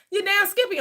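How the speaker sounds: high, sassy "you damn Skippy"; high sassy glee; gleeful. Acted. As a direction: crow with glee. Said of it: "gleeful taking of free gas".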